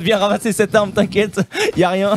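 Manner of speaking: high, teasing bait voice; mock taunt. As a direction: lure him in with a sly taunt. Mimicking the enemy's bait.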